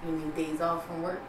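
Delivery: detached, token sympathy; unempathetic. Retold or imitated. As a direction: acknowledge it and move on. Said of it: "I don't have no em-, empathy".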